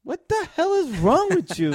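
high, loud disbelieving shout; incredulous. Real, not acted. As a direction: laugh out the disbelief. Incredulous outburst at pick.

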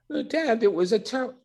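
playing the young son complaining; imitating his son.

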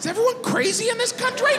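very high-pitched disbelief; exasperated squeal. Retold or imitated. Comic to the Irish comic, very high exasperated voice. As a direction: let the voice shoot up in exasperation.

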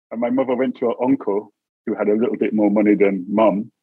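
ordinary talk, nothing marked

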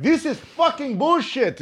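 high-pitched mock Israeli grumbling; grumbling angry dad. Israeli dad fuming before a call, mock angry voice. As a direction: imitate an irate Israeli father mid-rant. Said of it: "hardcore Israeli tough guy".

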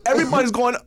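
ordinary delivery

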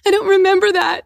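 high, loud mock denial; feigned innocence. Guest denies it to the man who spelled it out, high mock innocence. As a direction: squeal it in exaggerated cluelessness.

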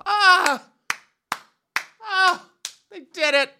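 high loud mock cheering; mock crowd cheer. Mocking a crowd cheering a straight couple, high shriek. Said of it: "they're like".

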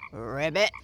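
deep gruff frog. Acted. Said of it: "in his deepest, gruffest voice".

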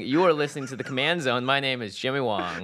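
ordinary delivery

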